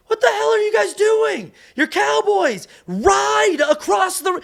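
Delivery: high, loud exasperated shout, slowing at the end; angry director yell. Retold or imitated. Director Kevin yelling at the actors after cut, high and loud. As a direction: bellow at them like a fed-up director. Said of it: "he goes".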